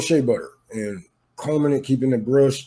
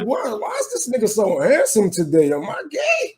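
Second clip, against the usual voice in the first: mock puzzled high voice; 11 semitones higher than usual. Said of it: "I'm like".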